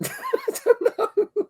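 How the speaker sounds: high, slow giggly laugh through words. Real, not acted. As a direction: laugh it out, drawn out and helpless. Host laughs at own confusion.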